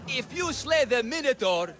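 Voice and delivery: normal talking voice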